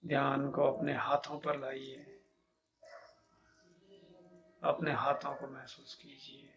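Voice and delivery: measured meditation guide, long pauses between instructions; guided meditation. Acted. Teacher leading listeners to feel each body part, calm meditation guidance.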